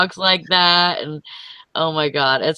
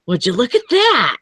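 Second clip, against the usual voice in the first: higher-pitched comic catchphrase voice; Ed Bassmaster; 8 semitones higher than usual. Acted. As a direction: swoop up high, then drop at the end. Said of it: "He does".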